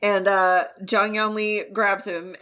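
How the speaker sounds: ordinary talk, nothing marked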